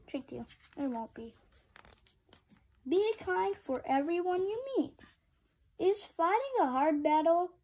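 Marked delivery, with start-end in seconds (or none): none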